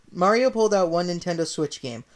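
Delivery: plain reading voice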